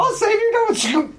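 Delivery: mock heroic cry.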